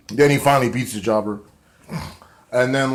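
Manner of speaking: ordinary talk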